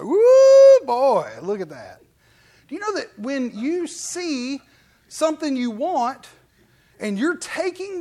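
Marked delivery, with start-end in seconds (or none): 0.0-2.0 hammed-up loud wolf-whistle woo; whooping at wife. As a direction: holler like seeing your sweetheart. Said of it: "every time I see my wife, it's like".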